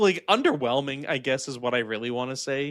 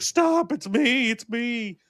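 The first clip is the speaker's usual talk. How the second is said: high, loud cry easing off; mock desperate plea; 6 semitones higher, 4 dB louder, slower than usual. Monster pleads as crowd rips her apart, mock desperate. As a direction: imitate someone begging attackers to stop.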